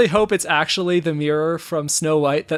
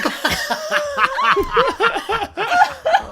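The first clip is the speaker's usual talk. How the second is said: real high-pitched belly laugh; burst of laughter. Real, not acted. Cracking up at the joke.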